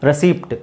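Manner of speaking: ರೆಸಿಪ್ಟ್ with a clear P before the T; mispronunciation demo. Shown as a demonstration. Teacher imitates what many learners say, ರೆಸಿಪ್ಟ್ with P sounded. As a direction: let the P be heard in ರೆಸಿಪ್ಟ್. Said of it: "ತುಂಬ ಜನ ನೀವು ರಿಸಿಪ್ಟ್ ಅಂತ ಹೇಳ್ತೀರಿ".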